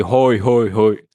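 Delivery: "rói, rói" delivered like ho-ho-ho; mock Santa chant; punning Santa laugh. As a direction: land the pun as a lightly voiced Santa.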